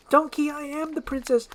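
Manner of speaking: high-pitched pleading to be believed; high, emphatic princess voice; insistent princess. Acted. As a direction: urgently explain who you are. Princess insists on her identity.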